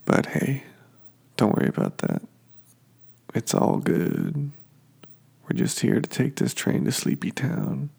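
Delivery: ordinary talk, nothing marked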